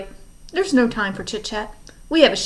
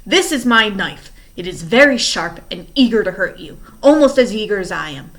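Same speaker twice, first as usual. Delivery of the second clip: much louder, menacing villain; furious threat; 8 dB louder than usual. Acted. Stefano threatens the orphans with a knife, loud and menacing. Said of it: "red with fury".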